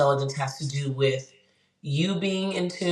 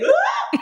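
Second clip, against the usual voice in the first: imitated child yelp; 5 dB louder than usual. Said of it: "and he's like".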